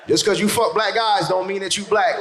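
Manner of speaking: usual speaking voice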